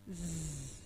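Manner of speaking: "Z." as a soft, quiet buzzing zzz; buzzing z sound. Shown as a demonstration. Teacher shows pupils the sound they hear, soft buzz. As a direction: softly buzz "Z." to model the sound. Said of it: "J'entends le son".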